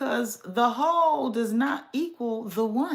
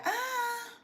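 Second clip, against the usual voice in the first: high, wailing mock cry; hammed-up tearful wail at the news; 10 semitones higher than usual. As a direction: whine it like you're about to cry. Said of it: "This news got me like".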